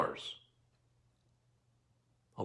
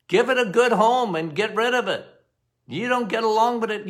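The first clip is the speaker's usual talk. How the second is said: ordinary talk, nothing marked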